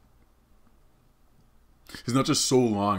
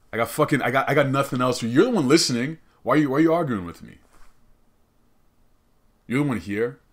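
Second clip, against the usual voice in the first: higher, louder mock exasperation; mock defensive arguing; 6 semitones higher, 7 dB louder, faster than usual. Host arguing with an imagined listener, mock defensive. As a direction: argue back hard, as if heckled.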